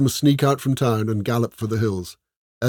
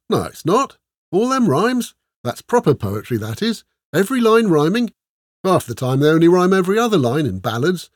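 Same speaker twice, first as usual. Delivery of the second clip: higher and louder, bubbling praise; enthusiastic Ola; 7 semitones higher, 5 dB louder than usual. A narrator's reading. Ola argues with Esmeralda about proper poetry, gushing and higher. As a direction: gush over every rhyme.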